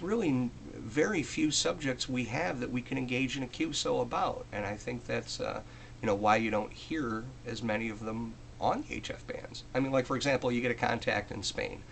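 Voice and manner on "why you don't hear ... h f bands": normal talking voice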